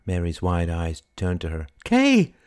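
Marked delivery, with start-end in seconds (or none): 1.8-2.3 high, loud greeting cry; high burst of recognition. Acted. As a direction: exclaim a friend's name. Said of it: "she cried".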